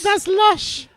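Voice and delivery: put-on falsetto Welsh girl echo; Welsh girl accent.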